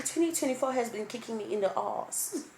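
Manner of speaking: usual speaking voice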